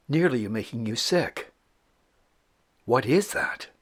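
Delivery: hushed, creeping campfire-tale voice, some words breathed; eerie story narration. Acted. Host telling the viewer a spooky trail encounter, hushed and eerie.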